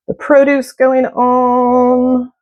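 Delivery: lightly put-on Cajun accent, high and drawn-out; mock Cajun chef. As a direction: stretch it slowly like a playful Cajun chef. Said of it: "speaking like a Cajun chef".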